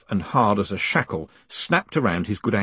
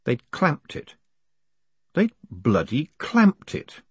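high-pitched, hushed disbelief; 6 semitones higher, slower than usual. A narrator's reading. Trapped man finds his horse clamped, disbelieving. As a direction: let the pitch jump on the discovery.

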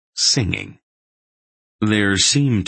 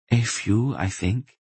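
captive woman's voice; 4 semitones higher than usual. Acted. Captive woman answers his question.

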